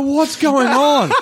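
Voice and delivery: high, drawn-out mock disbelief; comic bewilderment. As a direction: wail it slowly like a baffled bystander. Bystander's reaction to screaming couple.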